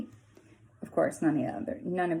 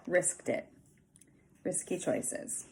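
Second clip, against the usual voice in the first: ordinary delivery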